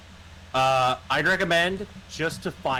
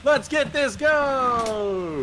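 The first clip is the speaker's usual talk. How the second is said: slow, high drawn-out shout; hype shout; 11 semitones higher, much slower than usual. Real, not acted. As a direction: stretch it out like a battle cry. Hyping up the start of round.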